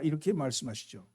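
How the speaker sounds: ordinary delivery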